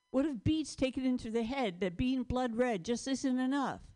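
usual speaking voice

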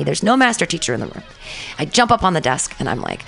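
usual speaking voice

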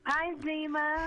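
high, slow put-on greeting. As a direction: sing out a mock hello.

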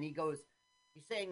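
ordinary talk

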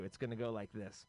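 normal talking voice

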